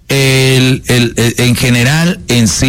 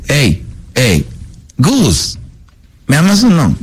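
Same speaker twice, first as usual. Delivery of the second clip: put-on demanding girlfriend; 3 semitones higher, slower than usual. Man voices a woman demanding love.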